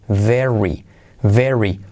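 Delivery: "very" said slowly with the r clear; pronunciation demo. Shown as a demonstration. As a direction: show the r in "very". (pronunciación de "very")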